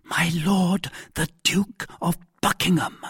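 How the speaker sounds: ordinary delivery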